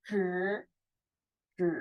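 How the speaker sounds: Zhi with the tongue curled right back; tongue rolled back. Shown as a demonstration. Teacher showing the rolled tongue, retroflex Zhi. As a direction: hold Zhi as a thick retroflex sound. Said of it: "lidahnya benar-benar kalian gulung ya ke belakang".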